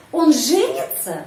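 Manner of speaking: женится rising sharply then falling; question stress on verb. Shown as a demonstration. Teacher asking whether he will marry, question stress on женится. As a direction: ask by punching up женится. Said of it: "Apakah dia akan menikah".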